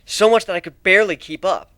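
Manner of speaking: ordinary delivery, nothing marked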